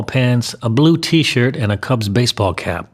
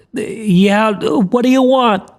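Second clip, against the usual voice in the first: put-on high, cracking boy's voice, drawn out; reply like a boy with a breaking voice; crackling teenage voice; 12 semitones higher, much slower than usual. A narrator's reading. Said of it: "His teenage voice crackled as he replied".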